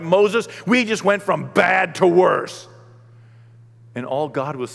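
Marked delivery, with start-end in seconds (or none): 0.0-2.7 slow, loud whining complaint; complaining Israelites. Acted. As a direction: complain loudly and slowly to Moses. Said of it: "and they're like".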